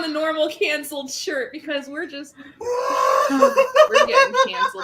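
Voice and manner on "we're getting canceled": high, slow laughing cry; laughing wail. Real, not acted.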